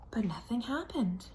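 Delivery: plain reading voice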